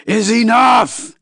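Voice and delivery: high, loud bellow of outrage; enraged alien bellowing at the room. Acted. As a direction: roar it out, stretching the words as patience snaps. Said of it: "he bellowed".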